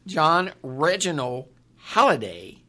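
slow, deliberate, every syllable spelled out; over enunciating. Real, not acted. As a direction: spell each name out slowly. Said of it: "Why are you talking slow for me?".

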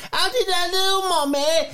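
put-on high child's voice asking; lightly voiced little kid. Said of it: "they go".

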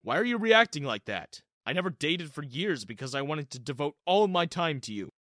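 a father defending his happiness; frowning dad. Acted. Dad scolding his son for reacting badly, angry. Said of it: "Dad gave me a frown".

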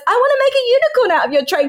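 put-on high, fast kid voice; imitated pestering child. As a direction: whine it high and quick like a child. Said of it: "some fucker comes along and just goes".

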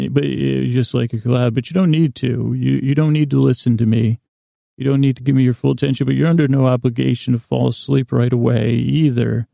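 normal talking voice

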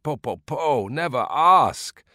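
put-on high, slow rueful old man; old man sighing over remembered thirst; old man exclaiming. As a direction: groan it out like an old villager.

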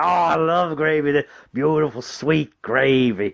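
high, slow, drawn-out savouring of the gravy; creepy gravy lover. Retold or imitated. Creepy host rubbing gravy on his chest, lingering high and slow. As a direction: croon it slowly like a creepy host relishing every word. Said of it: "He's proper enunciating".

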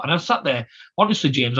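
ordinary talk

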